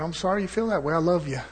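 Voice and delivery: soft and steady, refusing to fight; quiet reassurance to an angry man; calm, gentle reply. Retold or imitated. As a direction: answer the angry man softly, calm and kind. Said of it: "I said".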